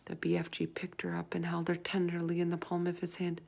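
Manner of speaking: ordinary delivery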